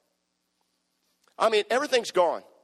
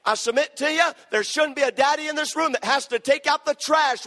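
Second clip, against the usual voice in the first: fervent preacher voice, raised; preaching; 6 semitones higher, 3 dB louder than usual. Acted. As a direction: preach it out with force. Preaching at full force.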